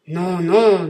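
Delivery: thundering vengeful genie; terrible genie voice. Acted. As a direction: roar it down at him like a giant. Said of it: "en persistant dans sa résolution".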